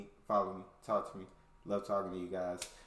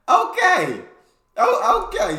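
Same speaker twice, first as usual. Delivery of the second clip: very high, loud exclamation; astonished shout; 14 dB louder, slower than usual. Real, not acted. Reactor taking in the kangaroo story, high astonished shout. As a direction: shoot the voice way up in amazement.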